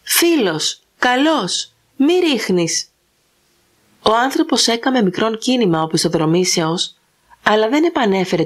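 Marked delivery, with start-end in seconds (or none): 0.0-2.9 put-on a frightened old man calling out, a little higher; urgent plea not to shoot; mild anguished call; anxious cry. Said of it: "έκραξεν εναγωνίως".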